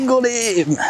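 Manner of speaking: high, drawn-out cry of triumph; real excitement. Real, not acted. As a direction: call it out as the fish is hooked. Angler hooks a fish.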